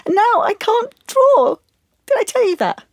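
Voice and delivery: high, strained outpouring close to tears; distressed and scared. Acted. Client telling therapist about the shared photo and losing drawing, scared and distraught. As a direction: let the voice climb as the distress takes over. That's what's so scary.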